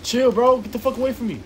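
high, loud frantic yell at the enemy; real panicked shouting. Real, not acted. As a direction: yell for them to back off. Panicked yelling at game enemy.

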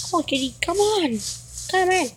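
normal talking voice

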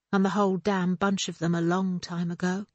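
ordinary delivery, nothing marked

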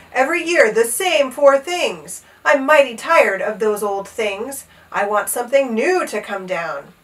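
storybook king's loud complaint, easing off; bellowing. A narrator's reading. Tired king demanding something new, bellowing. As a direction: demand it with moderate exasperation. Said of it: "bellowed the king".

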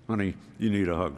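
soft, low, tender line; the preacher telling the congregation to speak tenderly; tender advice. Shown as a demonstration. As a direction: offer it gently, like a comfort. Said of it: "we need to say to somebody".

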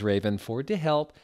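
plain reading voice

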